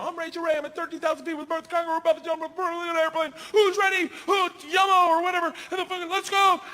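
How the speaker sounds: high, rapid TV host read building to shouts; Rachael Ray. Retold or imitated. Host does her one-take read at the plane door, hyped shouting. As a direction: race through a camera intro, then whoop it up. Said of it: "does her read, one take the camera".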